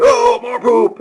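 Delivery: put-on high, loud, excited kid voice; eager little boy. Father imitating son suddenly keen on the potty. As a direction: chirp it like a child keen on a prize. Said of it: "And it was like".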